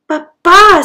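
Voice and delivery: Papa cried out high and loud with joy; joyful cry. Acted. Pancho sees his lost papa again, joyful cry. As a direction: shout Papa, overjoyed to see him. Son reunited with father.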